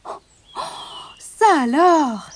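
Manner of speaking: gasp, then cry out in amazement; astonished cry. Acted. Barbotine reacts to the prince's secret, astonished cry. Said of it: "s'écria Barbotine".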